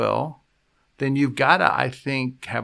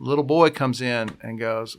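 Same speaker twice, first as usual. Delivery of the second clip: ordinary talk, nothing marked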